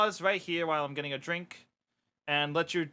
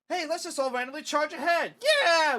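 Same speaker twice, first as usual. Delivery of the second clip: high-pitched rallying shout; eager cheer building into a loud yell; excited battle charge; 10 semitones higher, 4 dB louder than usual. Acted. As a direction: whip up a reckless charge. Comic troops charging off excitedly.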